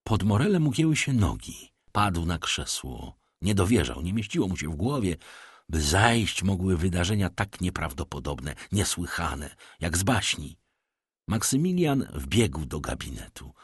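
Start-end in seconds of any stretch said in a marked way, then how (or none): none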